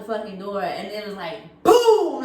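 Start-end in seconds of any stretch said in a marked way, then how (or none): 1.6-2.2 high, loud imitated bang; sudden boom. Imitating friends flying out the door, sudden loud boom. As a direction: punch it out as a sound effect.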